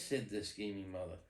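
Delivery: ordinary delivery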